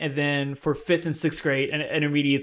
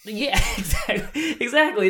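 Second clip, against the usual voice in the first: laughing, high excited agreement; 7 semitones higher than usual. Real, not acted.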